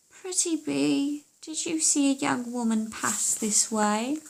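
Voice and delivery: slow, coaxing questioning; a hint of a sly daughter; sweetly asking the bee; lightly voiced witch's daughter.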